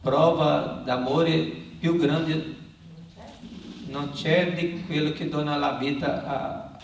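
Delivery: usual speaking voice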